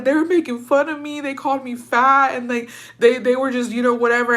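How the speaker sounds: high, upset whine as if losing it; retold bawling. Teller replaying herself complaining to dad, retold bawling. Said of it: "I just started bawling".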